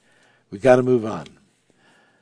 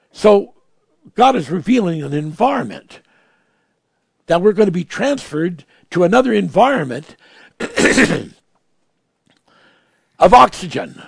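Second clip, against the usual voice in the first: louder, higher declaration; building preaching; 4 semitones higher, 6 dB louder than usual. Real, not acted. Preacher proclaiming to the church, loud. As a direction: swell toward the word oxygen.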